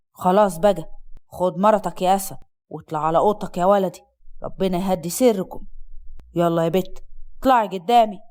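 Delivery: low-pitched father cutting in firmly; sharp intervention. A narrator's reading. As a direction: put an end to it, deep and firm. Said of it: "سالم بحدة اتدخل".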